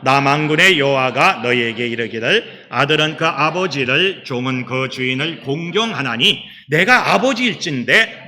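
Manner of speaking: firm unison reading aloud; scripture reading. Acted. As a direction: read Malachi together, steady and full. Congregation reads Malachi aloud.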